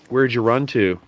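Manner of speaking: ordinary delivery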